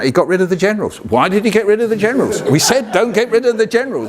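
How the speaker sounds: high-pitched mock indignation; indignant retelling of mum. Acting mum's indignant account of the battle.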